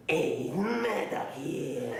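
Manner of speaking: slow put-on gruff character voice; trying out a character voice; Murdoch voice. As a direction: drag it out as the cartoon bassist. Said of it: "I'm gonna talk like this".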